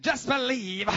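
loud, high southern preacher accent; exaggerated southern twang, loud. Shown as a demonstration. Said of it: "do it with a southern accent".

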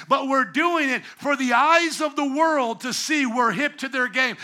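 normal talking voice

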